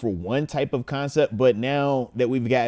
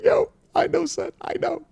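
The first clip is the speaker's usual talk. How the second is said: consoling dad. Retold or imitated. Telling his son he knows it hurts, high consoling. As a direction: comfort him like a hurting kid. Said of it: "I was just like".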